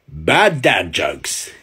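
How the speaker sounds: big, slow, loud show title. Acted. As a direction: boom the title out slowly. Title announcement of each joke.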